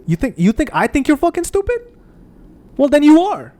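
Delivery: high loud mocking jab; scornful rant. Real, not acted. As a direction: climb up and slam the punchline. Angry rant escalating.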